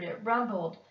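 ordinary delivery

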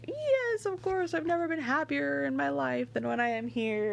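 gushing, high-pitched mock rapture; hammed-up high swooning reply; Willoughby.

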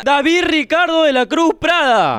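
high, projected announcer read; donor announcement. Real, not acted. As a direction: call out the name like an MC. Anuncia el nombre de un donante. (anuncio de locutor, agudo y proyectado)

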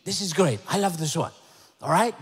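ordinary delivery

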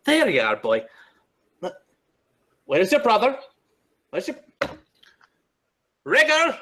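calling out high and loud for his pets; Nyctis calling his birds. Acted. Wizard calling his raven and owl, rising loud. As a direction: shout up into the trees for the missing bird. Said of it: "And Nyctis says".

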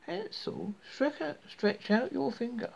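high, wheedling witch call. A narrator's reading. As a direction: coax him in a high witch voice. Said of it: "came to the cage and said".

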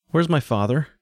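put-on high-pitched child's question; young boy wanting an answer. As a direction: ask as a boy looking for his mother. Boy demands his missing father.

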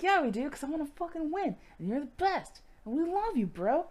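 high-pitched hyped-up teammate imitation; mock-earnest, high and keen; eager pleading. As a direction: coax a buddy with gushing enthusiasm. Voicing Worf eagerly urging Riker along.

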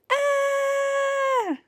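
long, high, held scream. A narrator's reading. Jim is grabbed by something slimy, high scream. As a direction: scream on one sustained high note.

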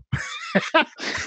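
sudden high-pitched laugh, real; high laugh burst. Real, not acted. To his friend, retelling the phone call, a high real laugh.

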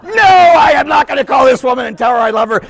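loud, high refusal; indignant shout. Retold or imitated. Priest refusing to call the woman, indignant shout. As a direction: shout the refusal in mock outrage.

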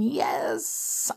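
plain reading voice

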